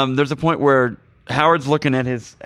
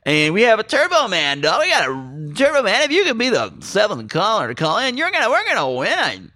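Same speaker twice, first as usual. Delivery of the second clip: excited, higher, swooping DJ patter; radio announcer; 7 semitones higher than usual. Acted. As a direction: hype the giveaway like a radio host. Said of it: "they hear a radio broadcast".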